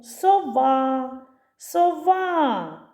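«Сова» called with long stressed final а; calling out. Shown as a demonstration. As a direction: call the owl, so-VAAA in «Сова». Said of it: "Покличемо сову". (кличе протяжно, наголос на «а» в слові «Сова»)

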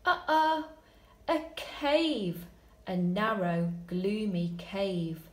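slow build, whispered tiptoe, high panicked escape; dramatic story reading. Shown as a demonstration. Teacher reading the longer ending, hushed then frantic. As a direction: read it like a scary bedtime adventure.

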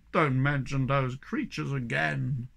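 slow, heavy, heartfelt plea; deep feeling. A narrator's reading. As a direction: weigh each word with feeling. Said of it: "with deep feeling".

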